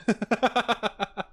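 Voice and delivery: quick high-pitched giggle; real giggle, high and light. Real, not acted. Streamer amused by the next one, high giggle. Laughing at own remark.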